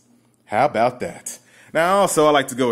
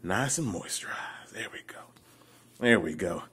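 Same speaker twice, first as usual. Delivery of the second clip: hushed whisper; 7 semitones lower, 10 dB quieter than usual. Pretend. Admiring the freshly lotioned leg.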